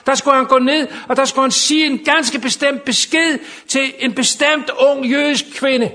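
high, loud, impassioned proclamation; full-force preaching. Real, not acted. Preacher proclaims God sends angels to help, full-force preaching. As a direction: preach it out to the whole hall.

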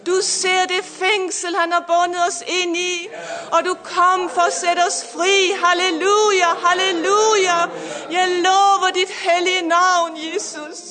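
fervent preaching. Acted. Pastor preaching to listeners online, fervent and rising.

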